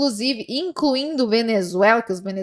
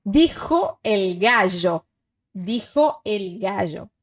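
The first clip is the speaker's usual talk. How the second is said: slow dictation; 3 semitones lower, slower than usual. Shown as a demonstration. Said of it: "reading dictation slowly".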